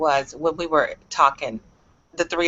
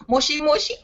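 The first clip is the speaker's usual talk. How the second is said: laughing mimicry; 7 semitones higher than usual. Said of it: "laughing mimicry of a Japanese phone greeting".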